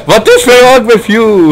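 high, slow, laughing shout; laughing outcry. Real, not acted. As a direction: cry out through laughter. Laughing at friend's bad driving.

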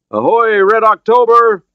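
high, loud ship's hail; hailing shout. Acted. Officer hailing the submarine from a raft, shouting. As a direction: call out to the vessel at full voice.

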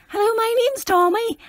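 put-on high-pitched little kid introduction; child's voice. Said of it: "The child is gonna be like".

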